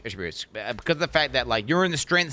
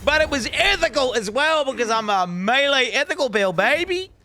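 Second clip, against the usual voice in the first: much higher and louder, slow hype; triumphant yell; 11 semitones higher, 6 dB louder, slower than usual. Real, not acted. Streamer bragging to chat about the melee build, hype yell. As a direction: hype yourself up at full volume. Celebrating instant boss kill.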